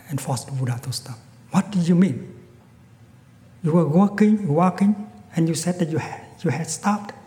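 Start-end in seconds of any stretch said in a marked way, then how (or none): 1.5-7.1 loud, higher, baffled anger; angry demand. Acted. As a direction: challenge him, confused and furious. Bandit blocks Buddha, demands explanation.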